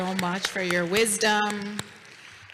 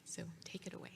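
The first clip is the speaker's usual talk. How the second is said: whispered; 20 dB quieter, faster than usual. Real, not acted. Hands mic to student quietly.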